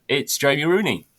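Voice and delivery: ordinary talk, nothing marked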